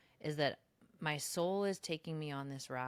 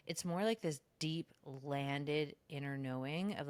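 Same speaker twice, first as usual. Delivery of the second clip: usual speaking voice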